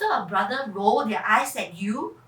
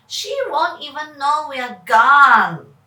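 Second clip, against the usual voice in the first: put-on loud cheeky boy; boy's voice; 10 dB louder than usual. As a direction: brush it off like a daring kid. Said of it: "Kevin says".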